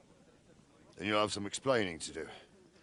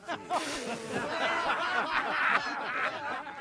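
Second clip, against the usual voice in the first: high cackling laugh. Acted. Laughing at an old memory.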